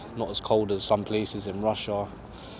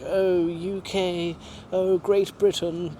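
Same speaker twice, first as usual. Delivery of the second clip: high, slow, drawn-out posh put-on voice; mock posh Brit; 8 semitones higher, 3 dB louder, much slower than usual. How Russians imagine posh Brits, mock posh drawl. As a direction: lay on a grand, exaggerated posh voice, slowly. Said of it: "they might think we're posh".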